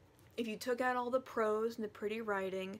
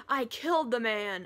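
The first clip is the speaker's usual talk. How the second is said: mock confession; 8 dB louder than usual. Retold Humbert's blurted confession.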